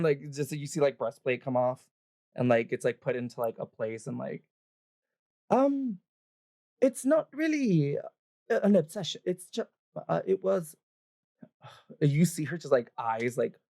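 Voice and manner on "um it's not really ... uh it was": high, halting, nervous excuse; caught-out half-elven woman, high-pitched; flustered stalling. Acted. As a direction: stammer for a cover story.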